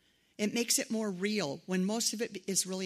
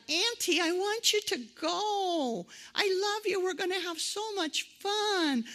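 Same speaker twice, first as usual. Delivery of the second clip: put-on high, slow, louder child's voice begging auntie; pleading little child; 10 semitones higher, 4 dB louder, slower than usual. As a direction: beg like a four-year-old. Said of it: "they sucked the four-year-old on me".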